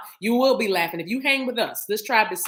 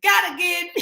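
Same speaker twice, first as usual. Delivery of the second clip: laughing; 7 semitones higher, 7 dB louder than usual. Real, not acted. Laughing while singing.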